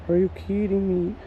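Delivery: high, stunned groan at himself; oh no, you blew it; exasperated disbelief. Real, not acted. Realizes wrong turn.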